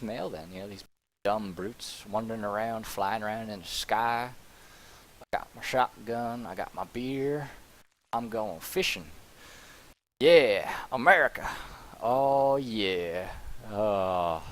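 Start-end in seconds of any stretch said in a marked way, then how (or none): 1.2-9.1 put-on slow good-ol'-boy American accent. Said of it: "doing a redneck American accent". 10.2-14.4 dopey cartoon bellow. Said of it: "doing a redneck American accent".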